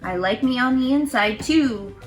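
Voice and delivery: usual speaking voice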